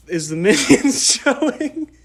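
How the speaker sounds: high, laughing mock-innocent ask; laughing question. Asking the theater workers, laughing at himself. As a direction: giggle through the question.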